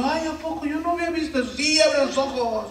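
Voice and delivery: high, breathy mock amazement; mock gossip voice. Pastor mocking commenters, in a high amazed voice. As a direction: mimic a gasping gossip.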